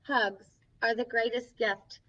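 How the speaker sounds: gentle picture-book reading; reading to children. Acted. Storybook host reading a friendship book to kids, gently. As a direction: read each page to the little ones.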